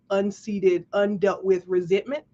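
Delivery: ordinary talk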